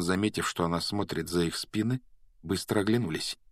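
plain reading voice

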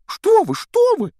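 high, alarmed squeak; frightened. A narrator's reading. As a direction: gasp it out in fright. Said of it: "испугался эльф". (испуганно)

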